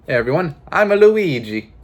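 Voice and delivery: put-on Italian accent, high, loud Luigi voice; bad Luigi accent. Repeats the Luigi bit.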